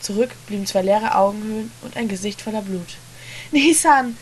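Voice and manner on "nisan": high, loud frantic scream; a boy screaming for his bleeding brother. A narrator's reading. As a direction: scream his name, beside yourself. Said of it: "schrie der Kleine außer sich".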